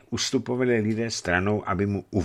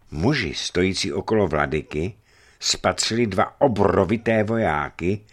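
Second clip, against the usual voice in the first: plain reading voice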